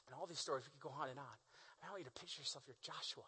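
very soft, low, weighty delivery with long pauses; hushed preaching. Acted. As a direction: let the stakes sink in, almost whispered.

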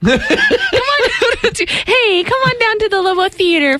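put-on high, laughing whiny girly voice; mock a girly announcer with a whine; whiny girl voice. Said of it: "That's so whiny".

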